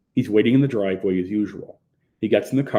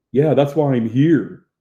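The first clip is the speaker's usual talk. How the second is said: loud, slow, higher retort; obnoxious retort; 4 semitones higher, 8 dB louder than usual. A narrator's reading. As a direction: lean on it like an oafish customer. Surly oaf answering the cashier.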